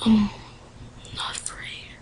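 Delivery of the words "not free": whispering. Real, not acted. Muttering menu text.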